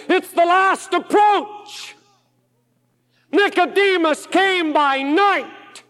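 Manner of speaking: high, loud shouted preaching, slowing on the last lines; preaching at full force. Acted. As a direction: drive it home like a revival preacher at the peak. Preacher building to a climax.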